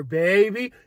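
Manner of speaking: ordinary delivery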